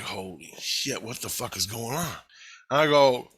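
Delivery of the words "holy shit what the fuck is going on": spooked and softly slowing down; scared hush. Retold or imitated. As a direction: breathe it out in quiet dread. Frightened by footsteps at night.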